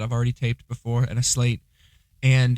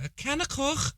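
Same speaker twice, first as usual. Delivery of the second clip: squeaky-high, soft Scouse caricature; Scouse accent; 13 semitones higher, 8 dB quieter than usual. Shown as a demonstration. Showing all he knows of Scouse, high exaggerated Scouse. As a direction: exaggerate Scouse with a throaty final k. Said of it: "All I know about Scouse".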